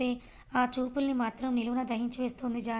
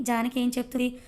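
ordinary delivery

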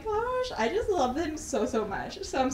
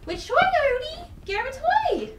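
coaxing dog; 11 semitones higher, 4 dB louder than usual. Real, not acted. Coaxing Odie to pick a toy, high eager baby talk. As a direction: urge the pup to pick one.

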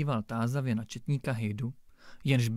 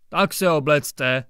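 slow, clipped order from the judge; curt snap; 8 dB louder than usual. A narrator's reading. Judge curtly ordering the brickmaker to dress, snapping. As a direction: cut him off with a curt command. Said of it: "utrhl soudce na cihláře".

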